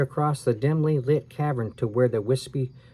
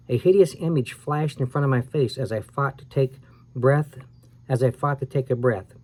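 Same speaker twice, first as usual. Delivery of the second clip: ordinary delivery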